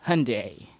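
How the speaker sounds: "Hyundai" as a neat HUN-day, Y barely there; correct pronunciation. Shown as a demonstration. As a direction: model "Hyundai" with a quick Y, ending in "day". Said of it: "you pronounce that as".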